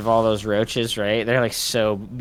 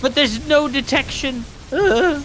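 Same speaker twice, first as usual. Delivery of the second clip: frustrated groan; 12 semitones higher, 4 dB louder, slower than usual. Real, not acted. Frustrated at missed detection.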